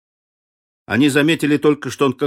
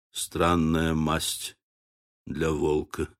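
low, soft and slow; low musing; 8 semitones lower, 8 dB quieter, slower than usual. A narrator's reading. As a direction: ponder it in a low, softened voice. Bill's musing line, narrator lowers voice.

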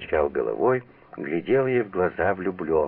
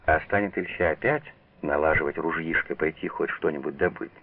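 ordinary delivery

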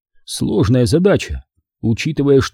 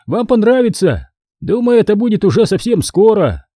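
higher, lively friendly soldier voice; cheerful giant warrior; 7 semitones higher than usual. Acted. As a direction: chat easily like a big cheerful veteran. Andrey chatting cheerfully.

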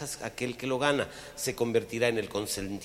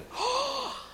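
gasp. Acted. Jadeo de sorpresa.

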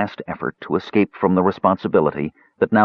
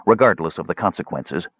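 plain reading voice

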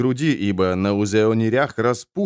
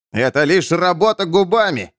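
raised, higher cry; shouting; 8 semitones higher than usual. A narrator's reading. Man argues back at the crowd, shouting. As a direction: shout over the protests. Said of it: "кричал Эмид".

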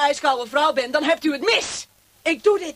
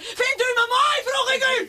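menacing taunt; 11 semitones higher than usual. Acted. Torturer repeats demand.

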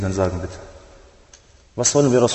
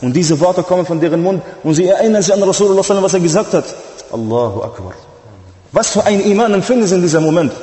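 high, loud sermon build, racing in places; impassioned preaching; 8 semitones higher, 5 dB louder than usual. Acted. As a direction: lift the congregation with fervour. Preacher evokes companions' memory.

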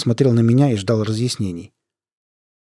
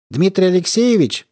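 raised phone voice; 7 semitones higher than usual. Acted. Caller on phone.

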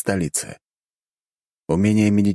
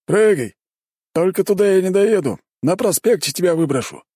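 gruff driver; 12 semitones higher, 4 dB louder than usual. Acted. Driver voiced by narrator.

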